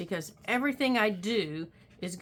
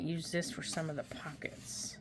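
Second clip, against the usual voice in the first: whispering; 3 semitones lower, 8 dB quieter than usual. Real, not acted. Whispered aside while crafting.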